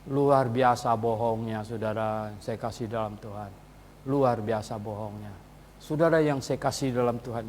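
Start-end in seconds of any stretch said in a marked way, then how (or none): 0.1-5.3 quiet scorn. Real, not acted.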